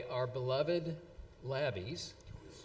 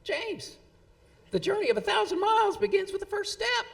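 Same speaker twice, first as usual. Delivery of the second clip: much higher, louder and quicker, in an exaggerated Tennessee twang; Jack Korn's Tennessee twang; 8 dB louder than usual. Speaker imitating his colleague's favorite saying, in a mock Tennessee twang. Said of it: "who would proclaim in his Tennessee twang".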